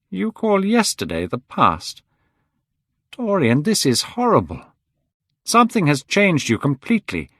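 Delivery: appalled Basil. Acted. Basil appalled at how Dorian has changed. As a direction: plead with rising dismay.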